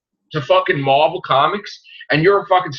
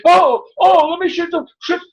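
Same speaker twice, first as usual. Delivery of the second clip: mock panicked villain; 10 semitones higher, 3 dB louder than usual. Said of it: "And he's like".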